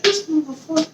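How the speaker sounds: disgusted aside; muttered disbelief. Retold or imitated. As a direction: grumble it under your breath in disbelief. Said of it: "you sit over here like".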